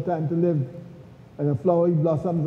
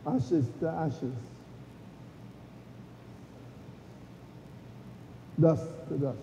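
hushed graveside prayer; 12 dB quieter than usual. Real, not acted. Graveside committal, ashes to ashes.

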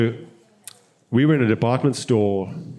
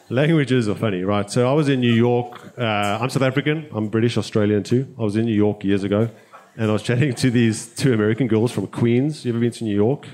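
usual speaking voice

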